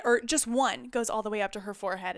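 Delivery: usual speaking voice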